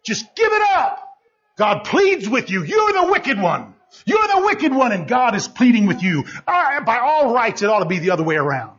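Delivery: high, insistent sermon delivery; fired-up preacher, stretching the key phrases; full-force preaching. Real, not acted. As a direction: drive it home with surging high pitch.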